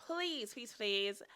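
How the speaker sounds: ordinary delivery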